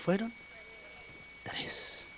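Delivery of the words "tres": whisper. Real, not acted. Whispered answer.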